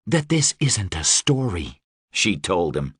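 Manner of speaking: low, smooth and seductive; sultry Deva voice. Acted. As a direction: purr it like a dangerous winged queen. Deva character voice.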